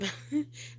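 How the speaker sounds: quick, high-pitched soft laugh; a light embarrassed giggle; short high laugh. Real, not acted. Laughs after asking for land.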